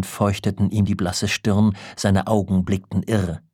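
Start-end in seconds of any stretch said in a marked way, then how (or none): none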